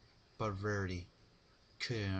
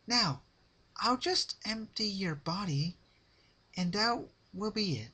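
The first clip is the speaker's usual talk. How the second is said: high, sweet, sinister pony voice; Pinkie's high menacing voice; 12 semitones higher, 5 dB louder than usual. Acted. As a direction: coo it like a cheerful killer. Pinkie's menacing line to Rarity.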